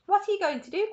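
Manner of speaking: high, loud anxious question; cried. Acted. Charlie cries out to Wonka, high and alarmed. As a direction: cry out in alarm, voice rising. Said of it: "cried Charlie".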